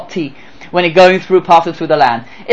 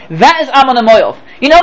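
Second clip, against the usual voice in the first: usual speaking voice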